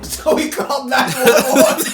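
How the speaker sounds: high, loud, laughing hard; can barely get it out for laughing. Real, not acted. Telling a friend the punchline, laughing hard. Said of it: "laughing while telling".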